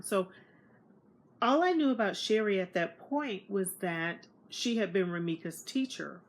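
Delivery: usual speaking voice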